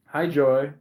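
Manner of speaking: ordinary talk, nothing marked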